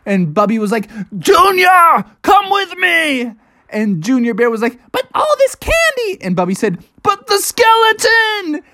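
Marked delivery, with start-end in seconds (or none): 1.2-3.3 high, drawn-out call to little brother; urgent shout. Acted. Bubby urges brother to flee. 4.9-6.2 put-on very high little-kid voice; protesting Junior Bear. Junior reluctant to leave candy. 7.0-8.6 high, slow, urgent cry; panicked protest. Acted. Bubby argues while in danger.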